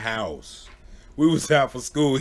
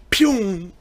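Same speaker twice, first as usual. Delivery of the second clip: whoosh sound effect; 8 semitones higher, 4 dB louder than usual. Retold or imitated. Friend running off, sound effect.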